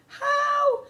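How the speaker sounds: very high, loud question; panicked squeal. A narrator's reading. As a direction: squeal it out in fear. Panicked boy.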